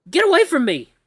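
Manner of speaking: high, loud scream of fear; terrified shout. Acted. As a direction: yell him away. Husky steps closer.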